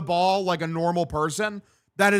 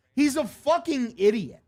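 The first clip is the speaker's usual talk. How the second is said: irritated insult, lightly raised; annoyed; 6 semitones higher than usual. Real, not acted. Gamer fuming at Wilson, irritated. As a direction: say it fed up, not yet shouting. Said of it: "repeats insult louder".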